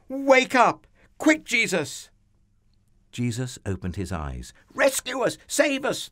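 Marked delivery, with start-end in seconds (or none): 0.1-2.1 high, urgent shout to wake a sleeper; panicked screaming. Acted. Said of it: "they screamed". 4.7-6.1 very high, loud plea; panicked shriek. Acted. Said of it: "they shrieked".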